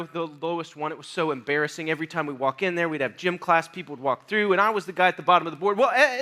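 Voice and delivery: ordinary talk, nothing marked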